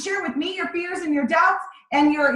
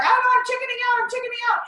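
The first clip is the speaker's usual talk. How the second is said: chickening out; 11 semitones higher, faster than usual. Retold or imitated. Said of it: "she's like".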